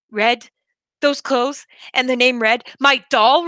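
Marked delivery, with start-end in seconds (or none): none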